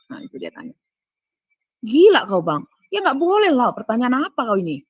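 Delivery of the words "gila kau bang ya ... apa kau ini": loud and high, shocked; outraged refusal. Retold or imitated. Telling the brother-in-law off for asking for a hug, outraged and loud. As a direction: throw the request right back at him.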